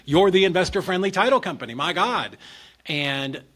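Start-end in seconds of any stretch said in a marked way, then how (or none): 0.1-2.4 louder and higher, fed up with them; loud exasperated retort; exasperated outburst. Retold or imitated. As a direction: throw it back at them in disbelief. Exasperated rant at title company.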